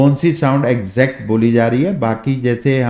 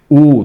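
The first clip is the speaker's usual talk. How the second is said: ऊ pushed out loud and heavy; forceful heavy oo; 2 semitones higher, 7 dB louder than usual. Shown as a demonstration. Teacher stressing the heavy ऊ sound loudly. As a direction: put force behind the ऊ. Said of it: "जोर लग रहा है".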